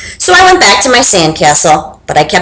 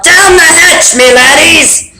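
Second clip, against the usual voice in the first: higher, toasting yell; captain yelling a toast, higher; pirate yell; 7 semitones higher, slower than usual. Acted. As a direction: roar a toast at the table. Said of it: "pounded his fist on the table and yelled".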